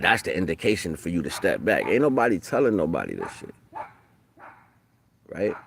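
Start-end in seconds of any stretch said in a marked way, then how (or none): none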